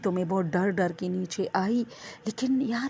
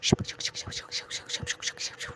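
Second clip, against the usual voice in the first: rapid unvoiced whispered shup sounds, much softer; imitated whispered noise; 9 dB quieter than usual. Telling friends about the sound behind her in the washroom, soft whispered hissing. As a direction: hiss out the eerie shup shup heard in the dark. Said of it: "اس طرح آواز آئی".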